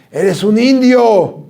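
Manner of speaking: slow, loud mock scolding; imitated teacher's yell. Chemistry teacher yelling at students in front of everyone, a loud mock scolding. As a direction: drag out the insult like an angry teacher. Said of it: "Enfrente de todos nos gritaba".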